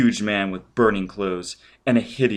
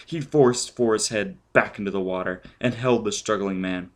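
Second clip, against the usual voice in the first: plain reading voice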